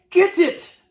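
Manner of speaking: very high, loud shout. A narrator's reading. Cooks chasing a mouse across the floor, shouting. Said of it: "they were shouting".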